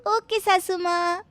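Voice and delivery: high, syrupy and eager; sugary bahu voice. Acted. Bahu handing her mother-in-law the knife, sweetly eager. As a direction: innocent helpfulness, laid on thick.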